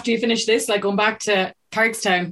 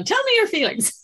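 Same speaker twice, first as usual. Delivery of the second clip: high, teasing mock therapist voice; 13 semitones higher than usual. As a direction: tease her like a pretend shrink. Teasing mock interview question.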